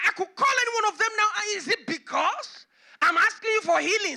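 loud, high, fast indignant tirade; indignant outburst. Pretend. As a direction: fire back, offended and boastful. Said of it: "She could have said".

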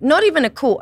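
can you believe this, a FaceTime?; incredulous outburst. Retold or imitated.

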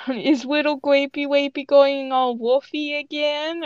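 high, babyish mock coo; lisping child voice. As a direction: tease in lisping baby talk. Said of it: "gained a lisp with her child voice".

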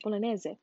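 soft trial bolognese, ending in a final e sound; Italian-style bolognese. Shown as a demonstration. Checking her Italian pronunciation to herself. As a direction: quietly repeat bolognese the Italian way. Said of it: "I think that's how you say it".

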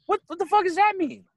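high-pitched mock outrage; imitated baffled ex. Ex questions him about profiling, high indignant imitation. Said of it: "She was like".

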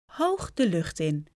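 usual speaking voice

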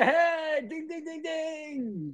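high excited ding-ding call; game show ding. Pretend. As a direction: ring out the correct answer. Correct answer buzzer call.

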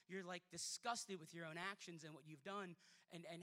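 normal talking voice

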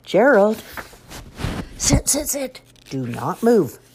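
high, eager little pig; bossy, bouncy piglet; excited pig voice. Acted. Piggy voice in picture book.

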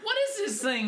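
high-pitched mock alarm; comically high puzzled question; retold bewilderment. Acts out puzzled find of taser.

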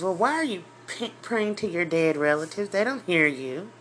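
ordinary talk

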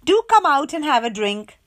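high, loud welcoming invitation; Miss Contrary hosting. A narrator's reading. Mother reading Miss Contrary welcoming her guest, cheerful. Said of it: "said little Miss Contrary".

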